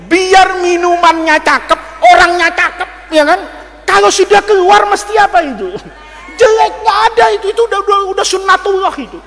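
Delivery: loud, high preaching to the crowd; preacher booming at the congregation; fiery preaching. Acted. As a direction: preach it hard. Preacher at full force.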